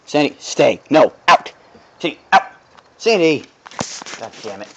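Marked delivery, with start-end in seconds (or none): 0.0-3.4 loud firm dog command; shouted dog command. Real, not acted. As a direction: bark orders at the dog.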